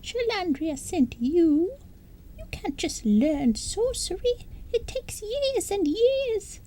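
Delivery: a tiny creature scolding furiously; squeaking tirade. Acted. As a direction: Roselle raging in a high squeak. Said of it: "raging and squeaking".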